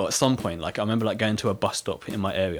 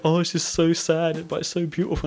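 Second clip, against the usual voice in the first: mock tearful teacher; 7 semitones higher than usual. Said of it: "she started to cry".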